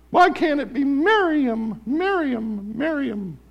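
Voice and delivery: high, slow self-pity whine; jealous whine. Retold or imitated. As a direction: whine your own name. Mimicking jealous Miriam.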